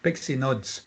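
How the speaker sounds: ordinary talk, nothing marked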